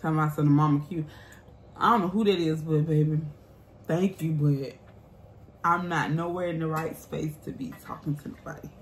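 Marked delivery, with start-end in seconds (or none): none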